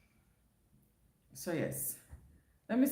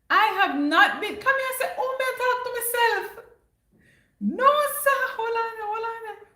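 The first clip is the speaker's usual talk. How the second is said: much higher and louder, flustered and rushing; flustered exclamation; 7 dB louder than usual. Real, not acted. Vlogger realizes she missed the chat, high loud flustered. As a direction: scramble to catch up, high and loud.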